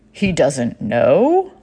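drawn-out, slow, mock disbelief; incredulous question. Online stranger questioning her about her partner, incredulous. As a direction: stretch it into an astonished question.

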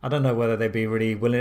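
ordinary talk, nothing marked

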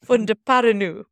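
Funda Paranu said like a French word, stress on nu; mock French Funda Paranu; mispronounced French-style. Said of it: "I was pronouncing it as".

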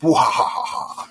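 high breathy evil "wah"; evil cackle. Retold or imitated. Teasing Devin over double wrapping, high breathy evil cry. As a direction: gloat with a wicked breathy cry. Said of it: "And it was evil".

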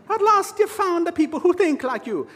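high, lilting mock Irish brogue; bad Irish accent. Preacher mocking church smugness in a bad Irish accent. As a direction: ham up a clumsy Irish lilt. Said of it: "that is the worst Irish accent I've ever done".

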